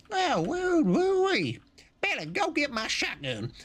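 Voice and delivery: put-on Texan accent. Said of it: "Say in a Texan accent".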